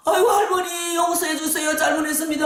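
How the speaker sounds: desperate wailing apology; begging cry. Retold or imitated. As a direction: cry out for forgiveness to grandmother. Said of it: "뭐 채에서 우는 거야".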